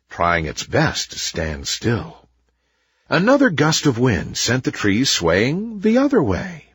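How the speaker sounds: plain reading voice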